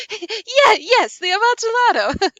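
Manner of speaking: cling to the joke through sobbing laughter; tearful laughter. Acted. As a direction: Fortunato desperately laughing along about the wine. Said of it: "a sad voice".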